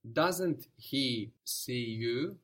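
drawn-out slow question; very slow prompt. Shown as a demonstration. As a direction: stretch it out so the student can follow. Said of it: "let's do that".

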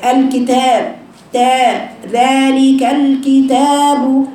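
slow الكتاب and ذلك, each long a held; two-count madd. Shown as a demonstration. Teacher demonstrating natural madd, long vowels held two counts. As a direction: stretch the تا in الكتاب evenly. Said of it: "ذا حركتين مد طبيعي".

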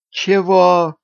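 a startled widow's outraged gasp; shocked high gasp of disbelief. A narrator's reading. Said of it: "ахнула вдовица".

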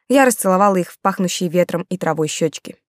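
ordinary delivery, nothing marked

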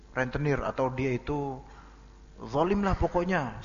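ordinary talk, nothing marked

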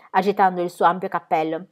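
usual speaking voice

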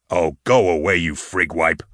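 lightly voiced surly bounty hunter; gruff dismissal. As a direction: wave him off with mild disgust. Gruff guard dismisses visitor.

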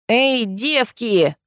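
put-on slow, drawn-out drunken hail; tipsy man calling. A narrator's reading. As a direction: stretch it like a tipsy villager calling out. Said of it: "донёсся с порога мужской голос". (пьяный протяжный окрик)

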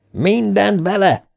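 high, slow rallying cry; raging yell. A narrator's reading. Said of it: "üvöltötte tombolva Eddie a többieknek". (tomboló üvöltés)

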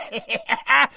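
high-pitched wicked cackle. A narrator's reading. Morfin gloats to his father, high cackle. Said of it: "cackled Morfin".

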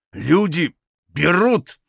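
slow, incredulous fury; furious repeating. A narrator's reading. Grigory repeats his father's excuse, slowly and furiously. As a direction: throw the words back slowly in rage. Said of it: "с бешенством повторял Григорий".

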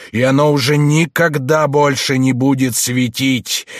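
slow, measured, ominous, building to thunder; doom prophecy from hushed to booming; cold rasping evil emperor. Acted. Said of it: "начал глухим, размеренным, зловещим голосом, постепенно повышая его до громовых раскатов".

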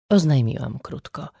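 ordinary delivery

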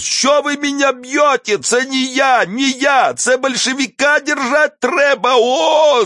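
very high thin howl; high-pitched desperate cry, words stretched; wailing in protest. A narrator's reading. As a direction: wail your innocence while being beaten. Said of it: "завопил он".

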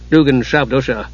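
plain reading voice

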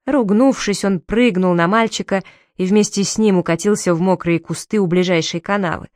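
ordinary delivery, nothing marked

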